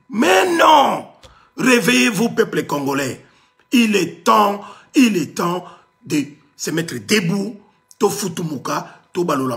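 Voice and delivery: shouted rallying cry, high and loud; loud soapbox ranting. Real, not acted. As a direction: rouse the crowd at full voice. Rousing the Congolese people to rise.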